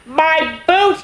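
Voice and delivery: high, delighted cry; a puppy thrilled by his present; joyful cry. A narrator's reading. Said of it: "he cried".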